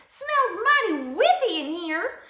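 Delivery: high, loud, slow mama bear. A narrator's reading. Mama bear smells the dusty sleeper, high voice. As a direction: draw it out high, sniffing out trouble. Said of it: "said Mama Bear".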